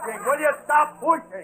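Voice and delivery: high-pitched cry to back off; harried protest. Acted. Man shoved by a mob of visitors, harried protest. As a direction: call out as the crowd shoves you.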